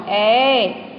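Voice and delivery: ए held out long and steady; long A sound. Shown as a demonstration. Teacher demonstrating long A to children, drawn-out vowel. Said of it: "like this, long".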